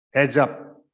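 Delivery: calling out. Acted. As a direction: warn the room as you enter. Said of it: "called the sergeant".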